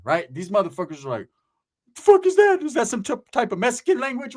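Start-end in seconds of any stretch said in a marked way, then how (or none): 2.0-4.4 high-pitched puzzled outburst; baffled disbelief. Retold or imitated. Kids hearing Ricky's strange word, high baffled question. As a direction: ask it high, thrown and incredulous.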